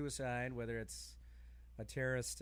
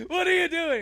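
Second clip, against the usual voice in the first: much higher and louder, squeaky alarm; shrill squeal of protest; 16 dB louder than usual. Pretend. Skit, Hannity shoving away his therapist, squealing. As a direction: squeal in mock panic.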